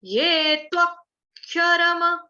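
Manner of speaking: त्वक्षरम with the final म fully said; slow, careful त्वक्षरम, last syllable ringing out; ये त्वक्षरम said slowly, every syllable full. Shown as a demonstration. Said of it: "म पूरा बोलना है".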